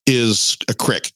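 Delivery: "crick" said with a short i, like "brick"; slow, with a short i in "crick"; crick, not creek. Shown as a demonstration. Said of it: "the way I understand it".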